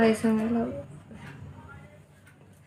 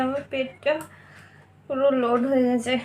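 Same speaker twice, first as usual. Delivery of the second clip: ordinary delivery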